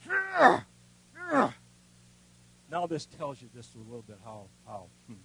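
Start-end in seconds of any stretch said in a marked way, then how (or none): 0.1-1.5 sharp, much higher and louder mock grunts of effort; attacker's grunts. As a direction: grunt like a man swinging hard. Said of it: "he's going".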